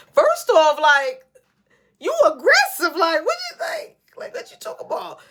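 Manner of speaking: high, loud laughing disbelief; incredulous outburst. Real, not acted. Protesting to viewers about a friend, high laughing disbelief. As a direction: squeal it like he's out of line. Reacting to friend's aggressive demand.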